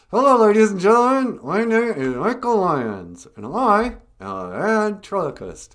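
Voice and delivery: put-on loud, high showman's greeting to the kids; stage host voice. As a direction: announce yourself like a stage act. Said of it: "I'd be like".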